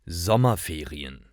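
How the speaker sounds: ordinary delivery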